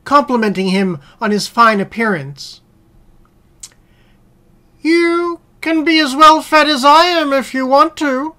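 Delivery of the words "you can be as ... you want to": higher-pitched persuasive dog voice; well-fed house dog. Acted. House dog advising the hungry wolf, higher persuasive voice. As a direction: coax in a raised character voice.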